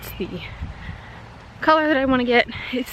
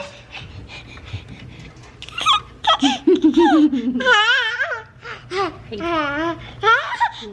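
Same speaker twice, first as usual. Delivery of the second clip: high delighted laugh; 14 semitones higher, 3 dB louder than usual. Real, not acted. Mother laughing at her baby on camera, high giggles.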